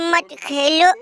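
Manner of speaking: usual speaking voice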